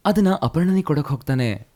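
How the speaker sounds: ordinary delivery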